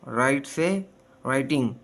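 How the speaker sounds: usual speaking voice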